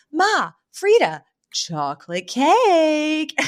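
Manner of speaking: high, stretched-out singsong announcement; mock grand presentation. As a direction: present it slowly like a big reveal. Acting out Fran presenting a cake.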